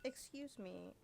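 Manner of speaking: usual speaking voice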